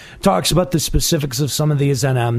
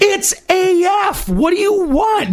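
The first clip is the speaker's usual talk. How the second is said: what more could you want; exasperated; 13 semitones higher than usual. Real, not acted. Defending the Dragoon design, exasperated.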